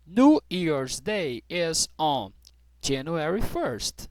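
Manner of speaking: slow, careful teacher model sentence; American version said slowly; slow model reading. Shown as a demonstration. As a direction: spell it out slowly for learners. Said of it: "eu vou falar basicamente igual tá escrito aqui".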